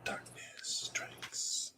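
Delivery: slow, hushed whisper. Acted. Host watching the horror movie, slow whisper. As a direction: whisper it very softly, drawn out. Echoing film's words while watching.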